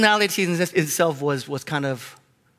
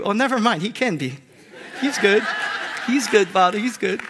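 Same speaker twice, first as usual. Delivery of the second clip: laughing nervously, quickly taking it back; sheepish laughing retreat; 3 semitones higher than usual. Retold or imitated. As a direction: chuckle and backpedal to God. Backpedals after God's question.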